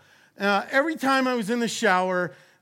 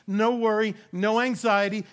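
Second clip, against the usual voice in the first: normal talking voice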